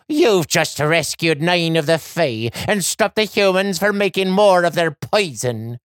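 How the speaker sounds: put-on old Irish leprechaun woman; reassuring Irish lilt. A narrator's reading. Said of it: "Missus McGrady says".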